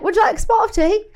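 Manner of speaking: high, fast posh-English put-on; hammed-up British voice; mock British accent. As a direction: play up a teatime English accent. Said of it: "they have that accent".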